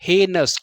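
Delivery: हेनस as HAY-nas, two syllables; silent i. Shown as a demonstration. Teacher showing correct reading, हेनस as HAY-nas with silent i. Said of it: "आई यहाँ पे रहेगा silent".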